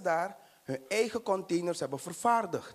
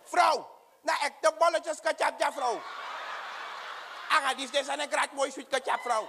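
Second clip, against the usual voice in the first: comic high-pitched pleading; voiced Gerald; 6 semitones higher than usual.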